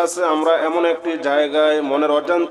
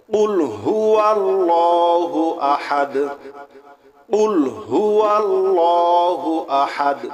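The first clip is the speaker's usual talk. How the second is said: slow, drawn-out melodic recitation; Quran recitation; 2 semitones higher, slower than usual. Acted. Imam reciting Surah Ikhlas to his listeners, slow melodic chant. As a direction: chant each word slowly and reverently. Recites verse slowly, repeated.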